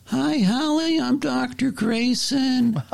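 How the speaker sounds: high, slow bedside greeting; gentle doctor. Retold or imitated. Doctor leaning over patient, high slow gentle greeting.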